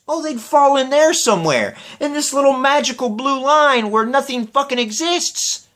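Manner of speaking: high, sneering voice; mock the silly answer; sarcastic mockery. Host ridiculing a quiz answer, sarcastic. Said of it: "mocking the crackpot logic".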